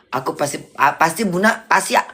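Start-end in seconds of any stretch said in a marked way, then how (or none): none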